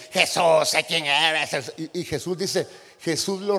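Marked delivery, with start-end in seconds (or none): 0.1-1.7 lento, grave, amenazante; demon voice. Acted. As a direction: arrastra las palabras como un demonio. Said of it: "el demonio le empieza a decir". (voz de demonio, lenta y amenazante)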